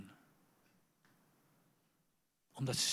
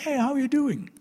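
high, loud, bright greeting; hammed-up friendly pastor; imitating pastor Gary; 12 semitones higher, 9 dB louder than usual. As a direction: mimic a jovial pastor's hello. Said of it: "pastor Gary".

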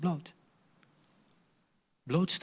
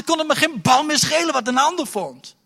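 ordinary talk, nothing marked

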